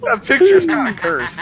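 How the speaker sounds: high laughing words; laughing hard. Real, not acted. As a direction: laugh through every word. Laughing at cursed picture.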